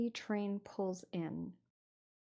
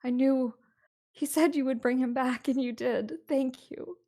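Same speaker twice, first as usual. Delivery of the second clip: starts whispered, then higher and louder thanks; breathless gratitude that swells; grateful wife; 6 semitones higher, 8 dB louder than usual. Acted. Wife thanking the three strangers, higher and emotional. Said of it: "she says".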